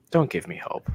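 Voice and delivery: a light hint of a movie hero's line; quoting Jeremy Renner. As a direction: murmur it like a weary hero pleading. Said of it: "To quote the incredible Jeremy Renner".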